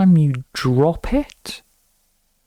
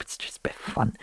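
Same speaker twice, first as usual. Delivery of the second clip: soft whispered throwaway; a breathy whisper, half to yourself; whispered aside; 13 dB quieter, faster than usual. Real, not acted. Quiet aside after the joke.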